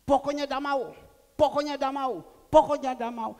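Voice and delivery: loud, high defiant insistence; stubborn refusal. Acted. Voicing stubborn rebellious refusal.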